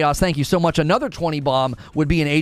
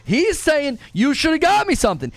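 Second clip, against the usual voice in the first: high mock girlfriend voice; playing the annoyed girlfriend, pitched up; 7 semitones higher than usual.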